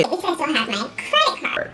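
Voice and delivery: very high, squeezed little voice; high-pitched mock-gleeful voice; squeaky aside. Mock-smug reply to the bank.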